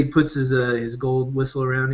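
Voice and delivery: normal talking voice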